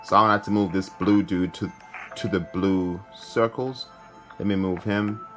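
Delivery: ordinary delivery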